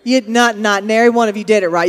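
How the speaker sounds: ordinary talk, nothing marked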